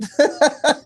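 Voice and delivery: high, loud real laugh; burst of laughter. Real, not acted. Host teased about his accent, bursts out laughing. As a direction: break out laughing.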